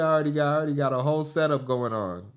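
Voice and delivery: usual speaking voice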